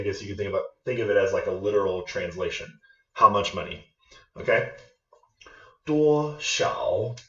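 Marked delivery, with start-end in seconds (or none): 5.9-7.2 "Duo" high and level, "xiao" dipping then rising; first then third tone. Shown as a demonstration. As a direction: hold "Duo" high, then let "xiao" drop and climb. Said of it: "first tone followed by third tone".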